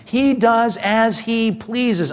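slow right down on "He does as he pleases"; slow emphatic reading. Shown as a demonstration. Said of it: "underline this".

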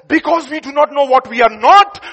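normal talking voice

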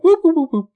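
high, loud siren-like whooping; mock alarm whoops. Mocking a clumsy criminal, high loud whoops. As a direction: hoot like a cartoon burglar alarm. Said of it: "waving his hands around to the sides and going".

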